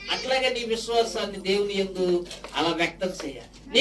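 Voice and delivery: ordinary delivery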